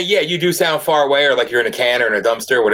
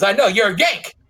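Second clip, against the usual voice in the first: emulating a Kentucky buddy; 7 semitones higher than usual. Retold or imitated. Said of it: "I'll emulate him".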